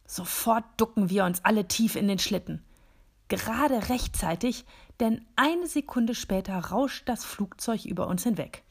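ordinary delivery

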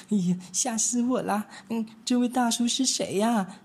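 ordinary delivery

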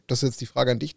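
ordinary delivery

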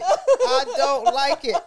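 slow, drawn out through laughter; laughing helplessly. Real, not acted. Laughing so hard she's crying, laughing helplessly. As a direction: wheeze it out while crying laughing. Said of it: "I am crying".

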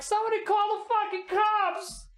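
very high-pitched alarmed cry for help; hammed-up high yell; panicked call-out. Vendor calls out for police.

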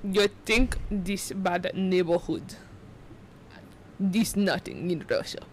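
slow, measured Russian accent; low-tongued, deliberate Russian accent; realistic Russian accent. Shown as a demonstration. Showing friend the tongue trick, slow Russian accent. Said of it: "more realistic sounding Russian accent".

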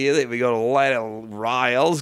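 put-on Australian bloke; Australian accent. Bloke weighs war against home, mock Australian accent. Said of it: "Australian different things".

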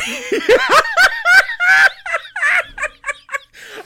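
loud high-pitched cackle; helpless shrieking laugh. Real, not acted. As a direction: burst into a long, shrieking laugh. Cracking up at own bit.

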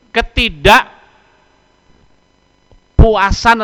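ketidakpuasan stretched very slow, high and loud; drawn-out stress. Acted. Preacher to congregation, dragging out ketidakpuasan. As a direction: lean hard into ketidakpuasan, dragging it out.

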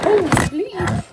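loud startled cry. Retold or imitated. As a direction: jump and blurt it out. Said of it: "and I was just like".

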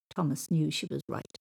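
plain reading voice

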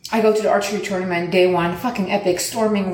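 usual speaking voice

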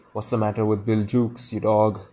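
usual speaking voice

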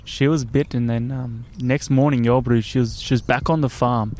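imitated Afrikaner camp owner; South African bloke reassuring a guest; South African accent. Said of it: "Great South African accent".

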